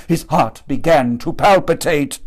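ordinary delivery, nothing marked

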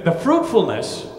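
ordinary talk, nothing marked

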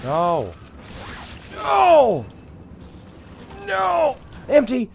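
very high, loud screams; desperate shouting. Real, not acted. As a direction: scream in panic at the game. Under attack with empty gun.

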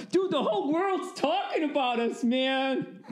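high, giddy buddy on the phone; hammed-up excited high voice; amped up. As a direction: squeal it to your pal in mock disbelief. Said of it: "Calling each other up".

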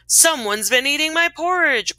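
a touch of storybook bear indignation; mild growl. A narrator's reading. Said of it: "growled the Papa Bear".

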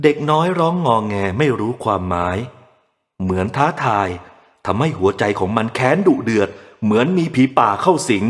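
ordinary delivery

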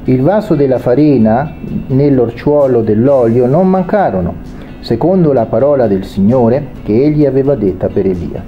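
ordinary talk, nothing marked

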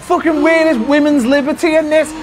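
mock outrage rant. Real, not acted. Loser ranting at his friend about Mario winning, mock furious. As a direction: rant like you've been robbed, half joking.

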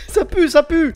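high, loud disgusted cry; outraged yell. Real, not acted. Player yells to viewers that things already stink, outraged. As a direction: shout it in real disbelief. Game starts badly.

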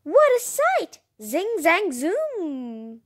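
squealing what a sight; excited exclaim. Real, not acted. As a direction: burst out in delight.

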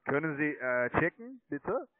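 usual speaking voice

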